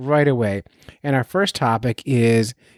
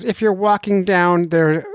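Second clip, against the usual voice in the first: lightly voiced doctor, pitched up; a higher, lightly caricatured doctor voice; mock doctor voice; 5 semitones higher than usual. Doctor friend gives advice on walking, light mock doctor voice. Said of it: "I'll do the voice work for him".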